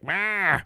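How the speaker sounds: high animal wail; imitated animal cry. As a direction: cry out like animals emerging in spring. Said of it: "coming out and just like".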